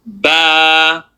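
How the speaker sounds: "Ba" with the vowel held long and level; stretched vowel. Shown as a demonstration. Teacher adding a letter to the stretched accent, long-held "Ba". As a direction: sustain the vowel of "Ba". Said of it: "stretch it out".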